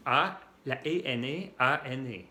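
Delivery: letters spelled out slowly; careful dictation pace; slow spelling. Shown as a demonstration. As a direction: spell each letter deliberately for students. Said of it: "se puede escribir con la E o la N".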